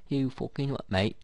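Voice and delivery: a playful London geezer retort; mock British accent. Streamer joking to viewers in mock British accent. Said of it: "with a British accent".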